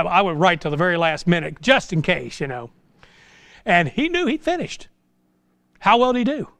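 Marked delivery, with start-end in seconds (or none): none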